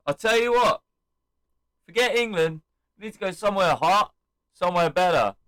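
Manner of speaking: re-enacted cocky wrestler; imitated heel promo. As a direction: sell your better idea on the mic. Acts out Waller's promo.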